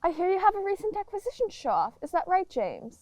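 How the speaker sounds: gushing hostess. Acted. Hostess gushing fast and high.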